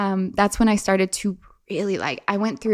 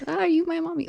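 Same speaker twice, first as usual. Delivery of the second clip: put-on childlike voice; 9 semitones higher, 5 dB quieter, faster than usual. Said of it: "It's just like".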